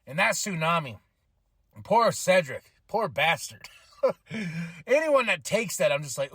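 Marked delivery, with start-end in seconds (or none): none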